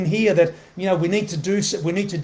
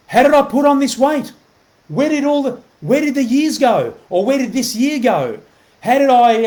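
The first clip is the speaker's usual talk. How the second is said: higher-pitched, acted-out dismayed questions; mock bewilderment; 7 semitones higher, 4 dB louder than usual. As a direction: wonder aloud in pretend disbelief. Acting out wondering what happened.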